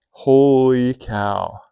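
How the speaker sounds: ordinary delivery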